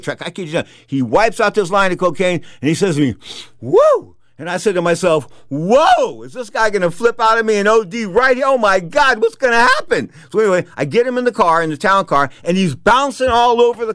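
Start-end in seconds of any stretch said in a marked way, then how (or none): 3.6-4.2 loud, high-pitched "woo"; exhilarated whoop. Retold or imitated. Boxer whoops after the line. 5.5-10.1 high, mounting retold panic; alarmed. Inner alarm at drugged boxer.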